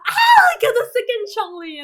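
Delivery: very high, loud squeal of joy; ecstatic squeal. Real, not acted. Gamer telling viewers she got Zhongli, ecstatic squeal. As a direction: squeal it out, overjoyed. Getting wanted character.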